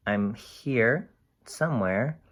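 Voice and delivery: ordinary delivery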